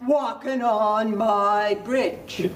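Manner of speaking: slow, drawn-out troll challenge; big storybook troll voice; bad-tempered troll. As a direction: roar it out slowly as a grumpy troll. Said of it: "old, bad-tempered troll".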